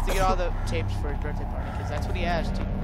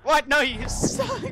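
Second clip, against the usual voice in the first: scared yelp; 10 semitones higher, 3 dB louder, slower than usual. Real, not acted. Jump scare reaction.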